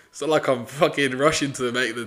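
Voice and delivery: ordinary delivery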